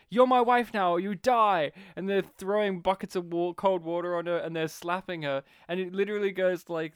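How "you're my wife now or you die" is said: mock villain threat. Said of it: "he's like".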